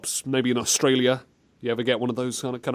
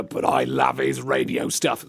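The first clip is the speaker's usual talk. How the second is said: much lower put-on voice; Susan; 12 semitones lower than usual. Susan gushes to host about Hyper, low put-on voice. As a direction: play a besotted fan pleading. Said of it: "Oh, but Susan".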